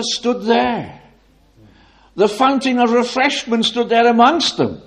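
loud, high, ringing pulpit voice; full-force preaching. Acted. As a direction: preach it out with rising intensity.

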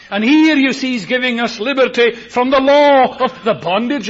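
high, loud fervent preaching; preaching at full force. Acted. Preacher booming about palm trees and Kidron, loud and high. Preacher quoting Romans eight, rising to a climax.

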